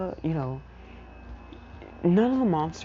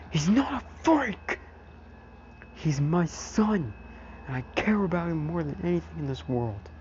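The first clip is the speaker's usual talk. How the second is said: Aizawa defending; 5 semitones higher than usual. Retold or imitated. Father figure defends son from villain, fierce outburst. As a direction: defend him fiercely, stretching the key words.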